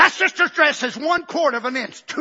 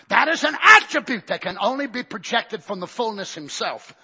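ordinary delivery

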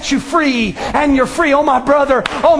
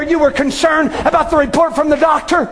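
ordinary talk

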